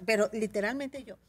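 ordinary delivery

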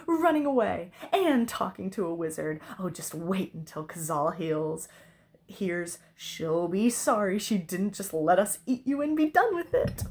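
gloating dragon, building to a loud crow; nasty triumphant glee. A narrator's reading. Dragon threatening princess with Cazal's anger, nasty glee. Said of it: "with nasty, triumphant glee".

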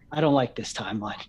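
ordinary talk, nothing marked

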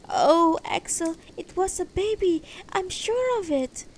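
high woman's voice, loud opening wail; distressed wife. Acted. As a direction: insist in high-pitched dismay. Tearful wife insists it was baby.

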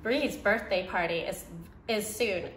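usual speaking voice

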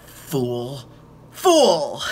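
hammed-up evil queen contempt; Disney villainess. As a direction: channel Maleficent scorning a rival. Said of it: "very Disney villainesque".

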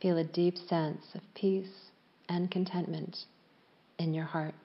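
calm yoga nidra voice with long pauses; unhurried, evenly pitched relaxation guidance; guided meditation. Real, not acted. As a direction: guide them gently into stillness, leaving space after each phrase.

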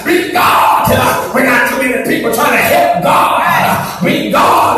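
preacher roaring to the church; full-force preaching. Acted. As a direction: hammer it home to the congregation.

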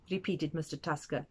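usual speaking voice

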